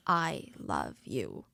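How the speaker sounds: low, slow, heavy I love you; very slow deep-voiced I love you; deep earnest I love you. Shown as a demonstration. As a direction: drop low and stretch out I love you as a weighty vow. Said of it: "the very strong emotional word for love".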